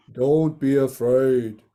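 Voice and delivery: low, slow, reassuring god voice; deep Krishna voice. Acted. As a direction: speak as Lord Krishna, low and calm. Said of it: "so Krishna says to".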